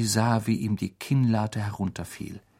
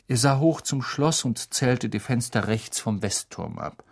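plain reading voice

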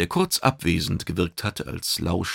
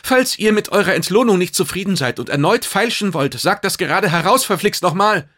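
agitated bird calling out high and loud; clacking-beaked bird; 12 semitones higher, 8 dB louder than usual. A narrator's reading. Restless bird challenging the customs officers, high loud outburst. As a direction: cry it out like a fidgety bird. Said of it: "rief mit klapperndem Schnabel".

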